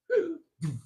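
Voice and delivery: a high, loud mock gasp from a chest bump; sharp high gasp. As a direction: gasp as if winded. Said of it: "He goes".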